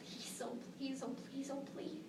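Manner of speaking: hushed, pleading whisper; desperate prayer. Acted. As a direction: beg under the breath. Teen praying over pregnancy test.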